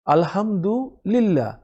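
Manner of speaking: আলহামদুলিল্লাহ slowly, with a light lla after li; slow recitation. Shown as a demonstration. Teacher demonstrates slow আলহামদুলিল্লাহ for learners. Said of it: "লামজের লির পরে আল্লাহ আসছে".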